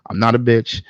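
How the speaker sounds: normal talking voice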